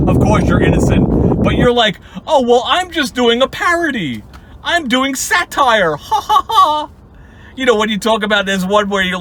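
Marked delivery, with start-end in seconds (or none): 2.3-6.9 high-pitched mocking imitation with fake laugh; hammed-up protest, ending in a fake ha-ha; mock excuse-making. Mimicking nemesis's flimsy satire excuse.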